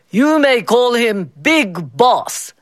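slow, pompous boss voice; grandly showing off his prize cricket. A narrator's reading. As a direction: announce it like a self-important boss. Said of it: "Mister Bao pompously told the workers".

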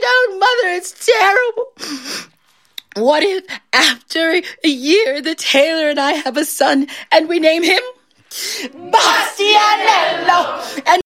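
high-pitched tearful wail; hammed-up weepy distress; weeping daughter. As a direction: wail it out to your mother, building to the name. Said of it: "There is her daughter weeping".

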